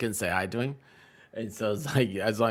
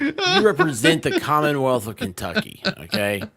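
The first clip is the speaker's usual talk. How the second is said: louder, laughing playful reprimand; half-joking telling-off; laughing mock scold; 4 semitones higher, 8 dB louder than usual. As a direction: scold her through laughter. Mock scolding chatter [name].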